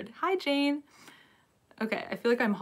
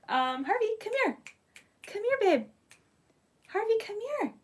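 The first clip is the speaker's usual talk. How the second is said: calling the dog; 12 semitones higher than usual. Real, not acted.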